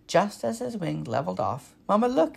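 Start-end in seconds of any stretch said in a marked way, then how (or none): 1.9-2.4 high, loud squeak to mother; baby bird calling out; squeaky excited call. A narrator's reading. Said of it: "he squeaked".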